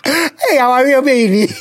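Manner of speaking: ordinary talk, nothing marked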